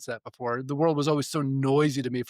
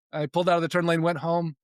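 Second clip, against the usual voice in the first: ordinary talk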